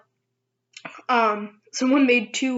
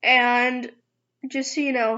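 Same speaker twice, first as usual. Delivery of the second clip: ordinary delivery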